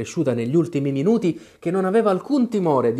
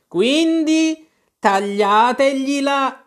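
high, slow, loud royal command, each word stretched; a shrieking queen drawing out her death sentence; furious queen; 13 semitones higher, 8 dB louder, much slower than usual. Acted. Queen ordering the court to behead the knave, high drawn-out yell.

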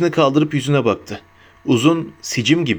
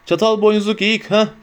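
higher-pitched, understated narrated roar; 7 semitones higher than usual. A narrator's reading. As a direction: lightly act an incredulous roar. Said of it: "diye kükredi barmen".

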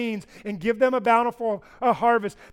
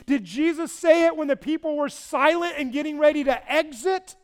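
loud, higher-pitched sermon delivery; forceful preaching; 6 semitones higher, 8 dB louder than usual. Real, not acted. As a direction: press the questions on the congregation with force.

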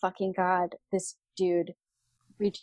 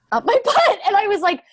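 laughing; 12 semitones higher, 6 dB louder than usual. Real, not acted. Laughing in disbelief at story.

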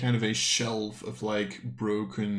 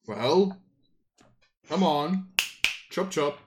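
raised, louder urging in short bursts; impatient chivvying; 6 semitones higher, 6 dB louder, slower than usual. Acted. Coin maker waits with open book, impatient chivvying. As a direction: hurry them along, pitched up and loud.

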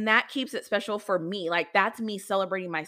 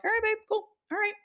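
husband's easygoing reply; 13 semitones higher, faster than usual. Retold or imitated. Husband's casual reply quoted.

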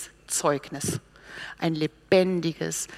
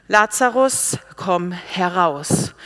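raised, commanding call with drawn-out words; loud command; 4 dB louder, much slower than usual. Acted. Jesus calls Lazarus out of the tomb, loud command. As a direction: summon him out with authority, slow and loud. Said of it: "rief er mit lauter Stimme".

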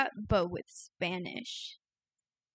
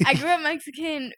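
laughing; 5 semitones higher, 6 dB louder than usual. Real, not acted.

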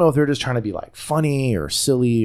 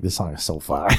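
low, soft, impressed voice; won-over listener; 5 semitones lower, 5 dB quieter than usual. Retold or imitated. Host recalling being won over by song, low impressed voice. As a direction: drop low and soft in approval. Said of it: "I'm like".

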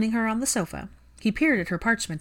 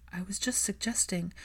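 softer, hurt and defensive; small, meek voice; 7 dB quieter than usual. A narrator's reading. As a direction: shrink back as you say it.

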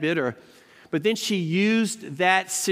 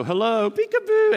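mock peekaboo greeting; 7 semitones higher, 5 dB louder than usual. Teasing silent Secret Service agents.

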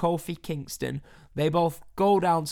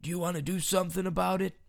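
quick, higher fake boss voice; badly faked wrestling boss, fast and pitched up; Vince McMahon; 4 semitones higher, faster than usual. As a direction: throw out a challenge in a bad promoter impersonation. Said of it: "that's bad Vince McMahon accent".

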